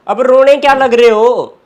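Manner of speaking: exasperated scolding. Acted. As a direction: scold someone for crying, impatiently. Scolding sister for crying.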